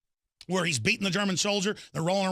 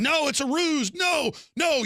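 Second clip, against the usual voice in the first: officer shouting; 7 semitones higher, slower than usual. Retold or imitated. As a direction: bark it like a frantic officer. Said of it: "the officers going".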